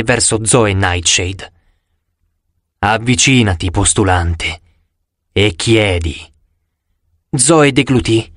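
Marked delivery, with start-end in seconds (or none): 2.8-6.2 low, eerie voice of a dead oracle; low hissing spirit of Delphi. A narrator's reading. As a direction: speak low as a mummy hissing in their heads. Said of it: "la voce dell'oracolo sibilò".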